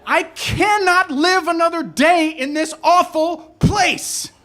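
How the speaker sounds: high-pitched, acted-out frantic rant; screaming meltdown. Cab driver banging on the steering wheel, screaming in despair. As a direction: lose it at the whole city, hammed up. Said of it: "I started banging on the steering wheel and screaming".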